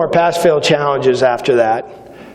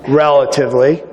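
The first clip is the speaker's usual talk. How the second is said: normal talking voice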